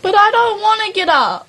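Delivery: put-on high, childish whine of protest; whiny refusal. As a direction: whine like you're being dragged from bed. Acted refusal to get out of bed.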